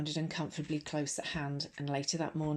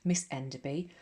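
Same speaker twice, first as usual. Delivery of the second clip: usual speaking voice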